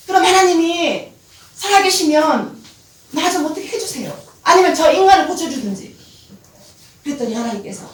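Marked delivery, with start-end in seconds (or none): 0.1-6.0 higher, louder pleading cry; desperate demand. Retold or imitated. Wife cries out to God, desperate and loud. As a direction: beg God to fix it, hard.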